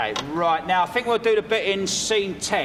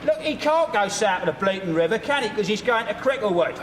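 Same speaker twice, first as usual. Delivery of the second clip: pleading with the actor; exasperated; 7 semitones higher than usual. Acted. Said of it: "director insists on the line".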